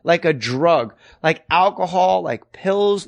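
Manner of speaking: ordinary talk, nothing marked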